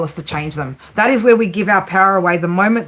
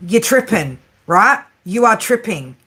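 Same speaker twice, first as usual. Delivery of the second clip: ordinary talk, nothing marked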